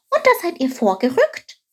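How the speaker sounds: high-pitched little story figure; curious Chilp. A narrator's reading. Chilp asks Quark whether they moved out then, high curious voice. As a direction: pipe up high with an eager question.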